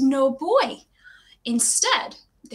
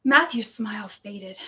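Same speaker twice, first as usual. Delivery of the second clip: usual speaking voice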